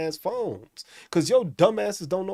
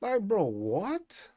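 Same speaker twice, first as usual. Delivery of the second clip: drawn-out, slow disbelief; slow baffled bro what; incredulous; 4 semitones higher, much slower than usual. Real, not acted. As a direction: stretch it out in disbelief. Incredulous reaction.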